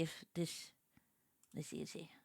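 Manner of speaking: whispered to herself. Real, not acted. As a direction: breathe it out searching. Muttering while searching.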